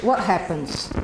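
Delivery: normal talking voice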